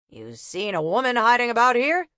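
a winded cop barking a question; gruff copper. Acted. Copper questioning a rag picker, gruff.

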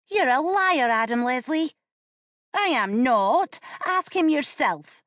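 put-on loud high indignant children; quarreling siblings. A narrator's reading. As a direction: fling the accusation back.

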